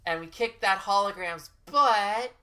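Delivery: loud slow gloating boast; triumphant. Real, not acted. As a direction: crow about the win.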